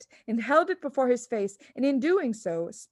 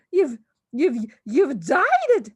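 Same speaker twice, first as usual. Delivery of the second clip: stammering shriek, higher and louder, slow; 5 semitones higher, 8 dB louder, slower than usual. A narrator's reading. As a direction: sputter it out in shock. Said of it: "shrieked the mother".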